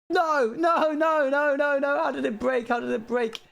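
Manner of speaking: very high, loud no's running into wailed questions; panicked wail. Real, not acted. Streamer as the build breaks, panicked wail. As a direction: cry out in high-pitched dismay. Contraption suddenly breaks mid-game.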